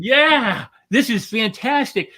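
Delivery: high, loud, slow "yeah, this is fantastic"; excited. Shown as a demonstration. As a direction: show real enthusiasm. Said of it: "is to go".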